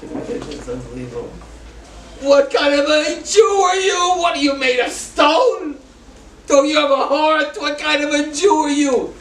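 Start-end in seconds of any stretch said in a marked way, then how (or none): 2.2-9.1 high, loud indignant yelling; angry shout; retold screaming. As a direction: yell at him, outraged. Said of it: "starts screaming at me".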